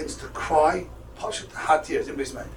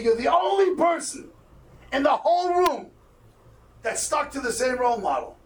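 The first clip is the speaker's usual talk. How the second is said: higher-pitched, retold astonishment; mild amazement; 7 semitones higher than usual. Office staff telling the student he stands alone, mildly amazed. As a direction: voice lifted on a retold finding.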